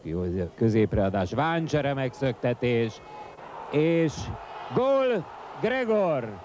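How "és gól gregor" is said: high shout of gól and the scorer's name; excited goal shout. Real, not acted. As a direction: erupt as the goal goes in. Goal scored, commentator erupts.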